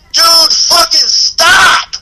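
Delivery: high, very slow, furious drawn-out stop; angry yell. Retold or imitated. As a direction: stretch out each word, fed up. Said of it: "So now I'm fucking pissed".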